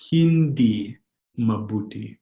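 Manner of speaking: hindi, pause, mabuti; word by word. Shown as a demonstration. Teacher repeating a new phrase for learners, word by word.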